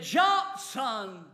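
put-on urgent father yelling to his child; yelled up. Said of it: "he yelled up".

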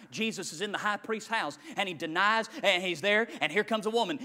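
ordinary delivery

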